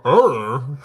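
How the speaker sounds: a muffled, wooden voiceover read as if through a thick wall; bad muffled voiceover. Shown as a demonstration. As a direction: say it like a dubbed voice heard through twenty feet of concrete. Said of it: "worst fucking voiceover ever".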